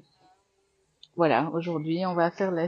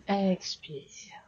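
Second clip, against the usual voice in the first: whispered breath cues; 3 semitones higher than usual. Acted. Teacher whispering breath cues as students flow.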